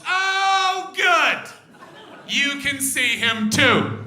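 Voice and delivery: high, loud delighted shout. Retold or imitated. Comic answering the married woman, a shouted high cheer. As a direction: yell it out with forced cheer. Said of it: "what I said was".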